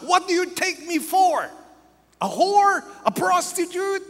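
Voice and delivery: high-pitched, shouted outrage of an insulted woman; angry and shocked. Retold or imitated. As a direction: shriek each question in scandalised fury. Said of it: "She was so angry. She was so shocked".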